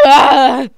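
sharp high yelp of pain, loud; startled yelp. Acted. Maurice bitten by Alex, a loud startled yelp. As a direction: yelp as the bite lands.

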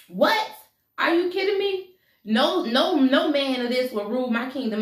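high-pitched, incredulous royal outburst; outraged disbelief. Acted. King rejects the witch doctor's prophecy, outraged. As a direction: refuse it in rising indignation.

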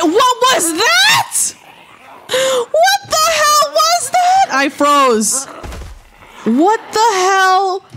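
high panicked shriek; shocked scream. Real, not acted. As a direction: scream it out in terror. Sudden death, screaming.

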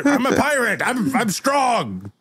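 high-pitched hurt protest; wounded pirate pleading in a high voice; whining pirate captain. Acted. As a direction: whine it high, insisting on being tough. Pirate captain acts hurt by taunts.

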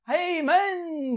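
very slow, high mock call of "hey man"; drawn-out shout. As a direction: stretch the call out. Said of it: "diye bağırıyorlardı".

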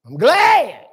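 Hey and I'm glad called out loud; loud, blunt retold reply; loud retort. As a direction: shout it back at the caller. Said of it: "And I say".

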